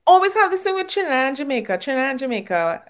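put-on a playful Trinidad accent, sung along. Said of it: "I had to say it in my Trinidad accent".

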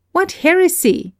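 high, sharp cry of protest; cried out. A narrator's reading. Narrator answers Galsworthy's view with an indignant cry. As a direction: fling it back as if shocked by heresy. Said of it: "I cried".

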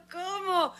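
high, soft mock squeal; stunned disbelief. Woman finding out she was overcharged, stunned. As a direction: re-enact shock in a small high voice. Learns ambulances should be free.

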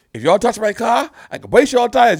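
high-pitched clip impression; imitated viral clip. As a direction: imitate a man warning people off his car. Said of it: "where your boy is like".

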